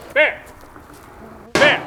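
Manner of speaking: high loud shout; scaring off a bear. Real, not acted. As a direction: yell to scare it away.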